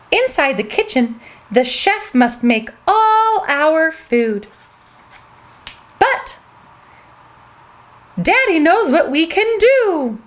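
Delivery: ordinary delivery, nothing marked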